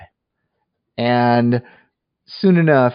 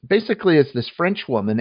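ordinary talk

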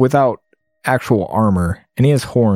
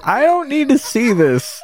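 high-pitched dismay; 14 semitones higher than usual. Real, not acted. Reacting to explicit scene.

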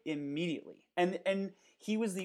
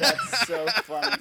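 laughing; 9 dB louder than usual. Real, not acted. Laughing at the blooper.

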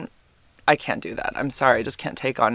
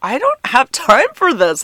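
exasperated; 10 semitones higher, 7 dB louder than usual. Retold or imitated. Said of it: "it's like".

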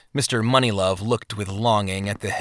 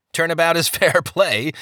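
laughing; 7 semitones higher than usual. A narrator's reading. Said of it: "the other man laughed".

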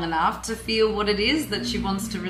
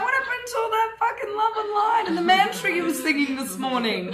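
mocking jab; 10 semitones higher than usual. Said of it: "It's like".

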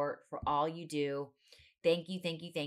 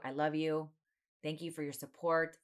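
usual speaking voice

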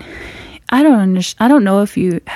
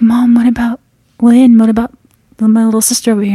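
anxious plea; 3 semitones higher, 4 dB louder, faster than usual. Retold or imitated. Worried question about sister.

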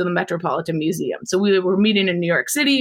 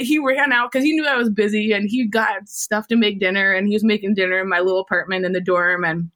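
usual speaking voice

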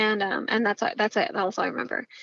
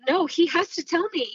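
mildly heated, pitched-up insistence; retold protest, pitch jumps up; insistent protest; 7 semitones higher than usual. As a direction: replay digging your heels in, higher voice. Retelling her heated argument with mom.

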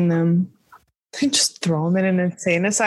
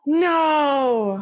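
long, higher drawn-out wail of disbelief; mock dismay; 5 semitones higher than usual. Co-host hearing about the mispronunciation, mock dismay. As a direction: stretch it out in playful horror.

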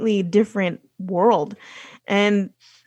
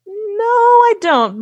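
drawn-out, high-pitched retold protest; emphatic denial; 12 semitones higher, 4 dB louder, much slower than usual. Retold denial of having an accent.

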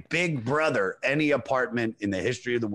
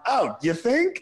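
sarcastic; 10 semitones higher, slower than usual. Real, not acted. Sarcastic reply to read quote.

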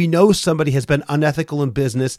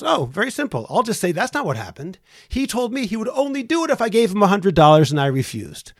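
mock-casual and much higher, brushing the worry off; smug, breezy dismissal; 8 semitones higher than usual. Boss breezily tells his partner how he'll lie. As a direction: play it light, as if the lie is nothing. Owner retold, smugly planning a lie.

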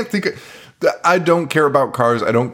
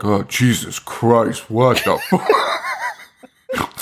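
mock disgusted jocks; slower than usual. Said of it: "They're like".

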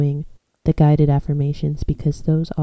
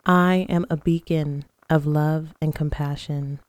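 guided meditation. Acted. Leads guided breathing and affirmations.

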